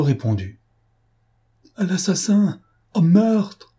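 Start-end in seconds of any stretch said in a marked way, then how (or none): 1.8-3.7 high, loud desperate calls out the window; the marquise calling to the house for help, frantic shouting; frantic cry for help. A narrator's reading. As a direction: scream for help, beside herself. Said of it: "cria la Créole hors d'elle-même".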